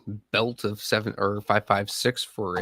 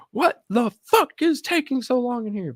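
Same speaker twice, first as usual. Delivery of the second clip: higher barked demand from a busted door; mock barging yell; 14 semitones higher, 3 dB louder than usual. Imagined team leader yelling as he kicks the door in. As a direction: bust in hollering at them.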